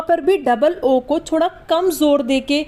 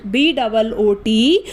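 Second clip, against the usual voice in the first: slow letter-by-letter spelling; slower than usual. Shown as a demonstration. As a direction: spell बी डबल ओ टी out slowly, letter by letter. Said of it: "spelling out the word". (धीरे-धीरे एक-एक अक्षर बोलकर spelling)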